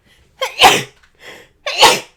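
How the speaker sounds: sharp loud cough; one loud hacking cough. Real, not acted. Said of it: "So I'm just gonna sneeze".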